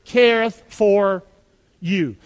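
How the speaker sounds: "Careth for... you", drawn out at half speed; say-along. Shown as a demonstration. Preacher leads congregation in reciting scripture, slow and spaced. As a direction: stretch the words, pausing before "you". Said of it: "say it with me".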